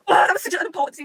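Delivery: high, loud fan outburst; overwhelmed gushing. Real, not acted. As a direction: burst out, overcome by the scene. Gushing over a favourite scene.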